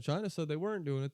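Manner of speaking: ordinary talk, nothing marked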